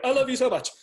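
high, loud fanboy outburst; starstruck gushing. Retold or imitated. Starstruck fan blurts out love.

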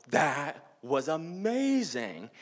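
slow, high-pitched awe, words stretched; amazed gushing. Retold or imitated. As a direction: draw each word out high, starstruck. Amazed friends run over.

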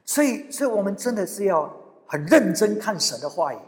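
ordinary delivery

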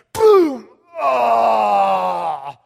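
loud mock crash and pained shout; hammed-up fall cry; imitated crash yell. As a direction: yell it like hitting the bar. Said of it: "I was just like".

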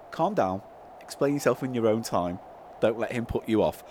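very low, soft, reassuring voice; Paul. Acted. Paul telling Toodle Pip to calm down, low soft voice. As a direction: drop right down and soothe gently.

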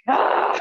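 loud mock gasp; sharp voiceless intake, acted out; shocked gasp. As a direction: gasp in exaggerated alarm. Acting the shocked reaction.

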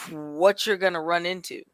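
usual speaking voice

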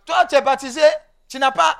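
usual speaking voice

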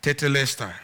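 normal talking voice

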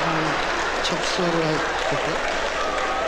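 low, very slow smooth pitch; smooth waiter voice. Retold or imitated. Waiter upselling the diner, low slow and smooth. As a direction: drawl it out low and silky like a waiter selling a dish.

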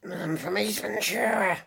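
hoarse, gravel-throated rapper impression. Rapper guest listing his films to the host, hoarse. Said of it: "Sounds an awful lot like [name]".

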